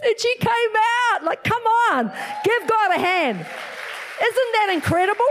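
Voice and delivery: high, exultant preacher's cry; rally the crowd to celebrate; jubilant. Real, not acted. Preacher urges congregation to give God a hand, jubilant.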